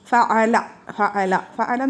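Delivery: ഫഅല said slowly and deliberately, syllable by syllable; teacher slowly sounding out ഫഅല for the class; slow drilling. Shown as a demonstration. Teacher drills the pattern word.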